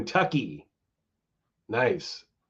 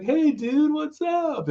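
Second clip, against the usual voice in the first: much higher, friendly laid-back dude voice; much slower than usual. Retold or imitated. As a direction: imitate a scary singer sounding surprisingly sweet. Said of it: "He's like".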